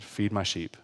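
soft, low, solemn instruction; hushed divine call. Retold or imitated. God speaking to him after weeping, retold in a hush.

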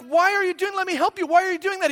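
high-pitched mock exasperation; acting out a flustered dad's high voice; exasperated dad pleading. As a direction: plead with your kid, pitched well up. Acted dad pleading with son.

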